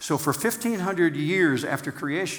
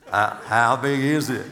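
hammed-up Australian accent, a touch louder; 5 dB louder than usual. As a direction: son says it sounds like Forrest Gump, buddy asks in Australian accent. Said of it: "just hear this guy".

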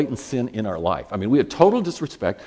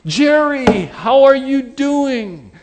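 put-on loud, high, drawn-out hello to an old pal; delighted greeting; 13 semitones higher, 8 dB louder, much slower than usual. Doc Jones looks up to greet his friend, high delighted shout. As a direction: exclaim it high and stretch it out. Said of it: "looks up and goes".

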